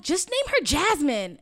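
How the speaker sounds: eager urging. Retold or imitated. Relatives pressing the mother to name the baby, excited and drawn out. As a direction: cousins insisting, drawn out and eager.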